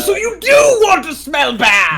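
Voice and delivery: loud mocking disbelief; teasing shout. Real, not acted.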